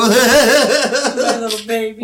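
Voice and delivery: slow, loud, laughing coo; laughing fondly. Real, not acted. Mother laughing fondly about her little daughter. Doting over the child.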